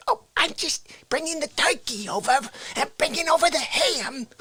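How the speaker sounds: high-pitched cartoon mom; mother voice. Mother greeting her son at Christmas, high-pitched put-on voice. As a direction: chirp it high like his mother. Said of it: "she comes over with a turkey".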